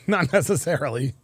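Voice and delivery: soft laughing repeat. Real, not acted.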